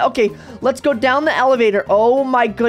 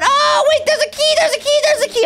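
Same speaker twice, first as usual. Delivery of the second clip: excited shout; 13 semitones higher than usual. Real, not acted. Kid shouting that he found the key, high and excited. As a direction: yell out the find.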